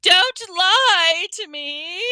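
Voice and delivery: high, slow wailing protest; upset accusation. A narrator's reading. Ghost girl accusing Hermione of lying, upset. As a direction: wail it out, drawing the words.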